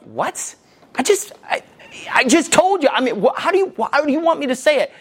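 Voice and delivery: high, stammering protest; exasperated disbelief. Acted. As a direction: sputter in baffled frustration, pitch shooting up. Husband's exasperated inner reaction acted out.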